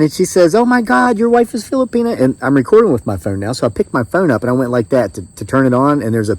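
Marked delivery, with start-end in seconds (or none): 0.5-2.2 put-on very high, delighted Filipino accent; mimicked Filipino accent. Doctor learning patient's wife is Filipina, mimicked Filipino accent. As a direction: imitate a thrilled Filipina doctor. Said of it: "I'm doing my best to mimic it".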